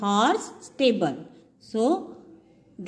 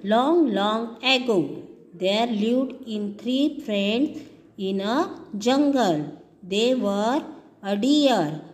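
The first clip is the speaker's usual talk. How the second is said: story narration; 3 semitones lower, slower than usual. Acted. Teacher reads story aloud.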